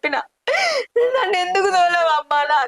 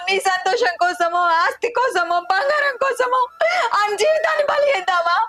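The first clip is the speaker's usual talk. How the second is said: much higher, crying out in protest; anguished shout; 5 semitones higher than usual. Acted. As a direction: hurl the question at her. Daughter confronting mother in quarrel. (బాధతో అరుపు)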